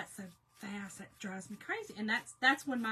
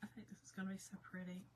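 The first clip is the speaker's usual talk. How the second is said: whispering; 2 semitones lower, 12 dB quieter, faster than usual. Real, not acted. Quietly admiring own painting.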